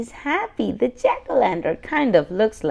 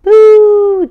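playful boo; 10 semitones higher, 14 dB louder than usual. Acted. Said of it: "Daddy says".

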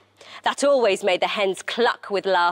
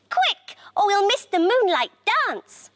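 high, urgent hen voice; excited storybook hen, pitched high; clucking hen; 11 semitones higher than usual. A narrator's reading. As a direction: hurry the other hens along. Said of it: "clucked Henrietta to the other hens".

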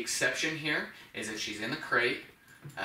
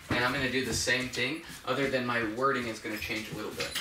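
normal talking voice

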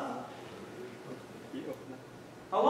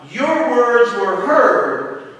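every word stretched and leaned on, louder; slow emphasis; 4 semitones higher, 7 dB louder, much slower than usual. Acted. Gabriel assuring Daniel, slow and emphatic. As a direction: let each word land.

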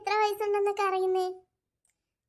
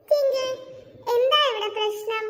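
confronting; 4 semitones higher than usual. Acted. Jeena storms in confronting Twinkle.

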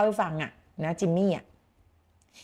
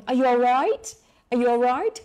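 higher, louder, a touch of concern in "Are you all right"; concerned friend; 4 semitones higher, 5 dB louder, much slower than usual. Pretend. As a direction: ask a friend if they're all right, a bit worried. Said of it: "ฝรั่งคนอังกฤษชอบใช้มากเลย".

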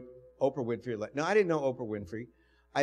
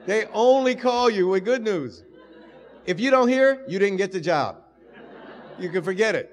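much higher and louder, laughing as you go; excited; 6 semitones higher, 9 dB louder than usual. Real, not acted. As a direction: tell the students, overjoyed and loud. Excited lecture to students.

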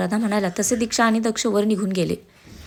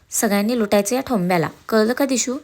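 usual speaking voice

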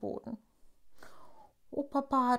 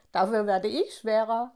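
laughing; 6 dB louder than usual. A narrator's reading. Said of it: "lacht Tafiti".

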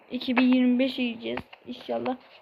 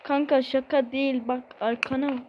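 normal talking voice

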